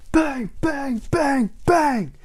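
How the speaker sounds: high, loud banging noises; loud knocking sounds. Acted. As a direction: hammer out the knocks. Postman knocking on the door.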